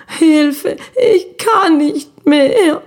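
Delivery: high, slow, weepy cry for help; a frightened child whimpering from far away. Acted. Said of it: "Es war nur noch ein Gewimmer". (wimmerndes Kind, hoch und langsam)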